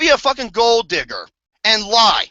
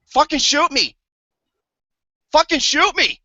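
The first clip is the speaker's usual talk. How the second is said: mock despair; 4 semitones higher than usual. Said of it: "I was just like".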